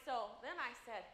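ordinary talk

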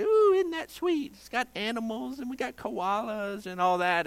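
high, gushing mock sweetness; exaggerated high-pitched fawning, gently mocking; mock cooing. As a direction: coo over it like something adorable. Mimics people cooing over nursery art.

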